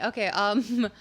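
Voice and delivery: normal talking voice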